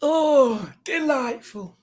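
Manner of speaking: high, hammed-up old northern man voice. Making tea for the viewers, in a silly old northern man voice. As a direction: play a doddering northern bloke making tea.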